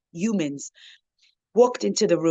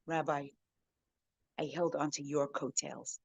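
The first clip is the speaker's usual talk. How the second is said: much softer and lower, sincere; tender reply; 3 semitones lower, 11 dB quieter than usual. Retold or imitated. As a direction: let it land softly, almost a confession. Atheist's tender punchline.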